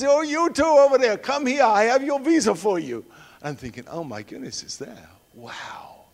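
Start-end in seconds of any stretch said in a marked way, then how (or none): 0.0-3.0 high, loud calling out across a hall; shouting. Retold or imitated. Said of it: "He shouts out". 3.9-6.0 hushed, awed whisper to self. Retold or imitated. Inner thought in awe.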